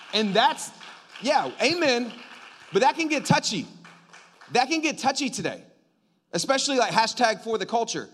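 loud, high, driving pulpit voice; preaching at full force. Acted. Preacher pressing the kingdom-before-culture point, loud and driving. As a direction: hammer the point home to the church.

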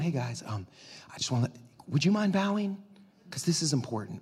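soft, low, hesitant ask; mock timid request. King meekly asking the three to bow, mock timid. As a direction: play a meek king, hushed and apologetic. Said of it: "the king was not whispering".

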